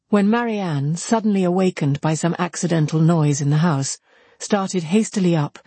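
usual speaking voice

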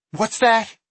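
high, sharp sheriff challenge; sharp question. Acted. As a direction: snap the question at him.

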